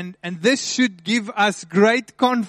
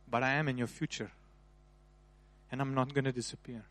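much softer, hushed and tender; intimate, reassuring near-whisper; tender voice of God; 2 semitones lower, 6 dB quieter than usual. Acted. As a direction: speak it gently like a loving father to his child. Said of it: "but God is saying".

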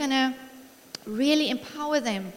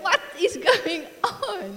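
higher, drawn-out mock bewilderment; baffled husband; 7 semitones higher, slower than usual. Husband at his wits' end with crying wife, mock-baffled. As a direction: act out an exasperated husband, slowly. Voicing exasperated husband in a spat.

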